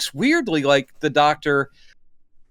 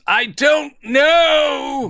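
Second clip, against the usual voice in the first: a hammed-up exasperated yell; mock shout; 9 semitones higher, 5 dB louder, much slower than usual. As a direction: blurt it out like a fed-up doctor, slow and loud. Doctor yells in retold scene.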